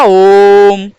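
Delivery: ओम sustained on one level note; drawn-out ओम. Shown as a demonstration. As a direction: demonstrate ओम with a long held vowel. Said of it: "इसका उच्चारण जैसे ही हमने किया". (ओम का लंबा उच्चारण)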